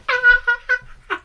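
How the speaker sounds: very high shocked shriek; hammed-up gasp-scream of surprise; high shrill mock scream. Chinese realises Edmund alive.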